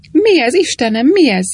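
panicked exclamation at the burning tower; alarmed cry. A narrator's reading. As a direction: cry out in shock at the sudden fire. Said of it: "kiáltott Sir Cecil Fanshawe".

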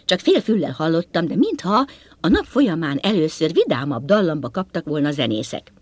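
usual speaking voice

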